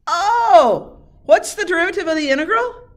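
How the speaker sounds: high, loud "oh" into an eager question; sudden realization. Shown as a demonstration. Teacher leads class to the key step, sudden realization. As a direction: pitch it up like a discovery.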